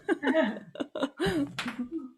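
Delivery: light, high-pitched chuckling; a real, easy laugh to yourself; amused chuckle. Real, not acted. Workshop host joking about her husband's gaming, chuckling.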